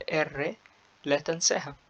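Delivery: ordinary delivery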